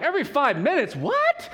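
a startled husband going shrill with alarm; sudden panicked what; shocked disbelief. Retold or imitated. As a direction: voice jumps up high and loud in panic. Panicked husband realizing labor speed.

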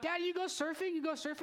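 put-on high eager child's voice; mimicking his young daughter asking; little daughter. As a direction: pipe up like an excited kid. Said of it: "my daughter comes up".

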